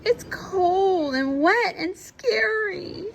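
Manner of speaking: high slow fearful voice; slow high voice of a frightened duckling; worried child duck. Acted. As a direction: drag it out like a scared little one. Worried character in story.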